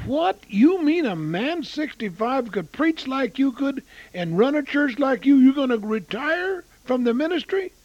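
high, loud startled protest at retiring; stunned disbelief. Retold or imitated. Preacher to retiring pastor, shocked disbelief. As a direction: blurt it out, shocked he would quit. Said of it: "And I was stunned".

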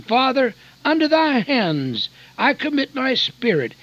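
loud, high cry to the Father; Jesus' last loud cry from the cross. Acted. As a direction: call it out with all your voice. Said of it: "crying out with a loud voice".

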